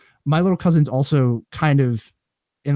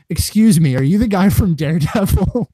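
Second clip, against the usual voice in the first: lightly voiced mom, a little higher and louder, politely eager; a hint of mom asking a celebrity politely; 7 semitones higher, 8 dB louder than usual.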